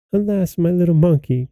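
high-pitched, mild lament; lamenting cry. A narrator's reading. Princess mourns her dead little monkey, lamenting. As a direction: exclaim in understated sorrow. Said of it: "cried the princess".

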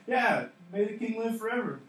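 high, loud acted call; yelling back. Preacher as Daniel answering from among the lions, yelling back. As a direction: call up to the king from below. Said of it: "He can yell back".

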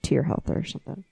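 dropping to a murmur; quiet. Real, not acted. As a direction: let it fade away. Trailing off, quiet.

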